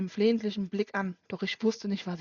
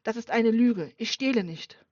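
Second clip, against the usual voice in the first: loud protest; 2 semitones higher, 6 dB louder than usual. Acted. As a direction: shout the denial desperately. Accused friend protesting loudly.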